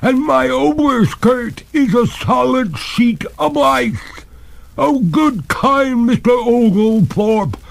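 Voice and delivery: high, slow, loud pleading; despair. A narrator's reading. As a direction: beg for mercy in a ghostly wail. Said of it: "cried the ghost in despair".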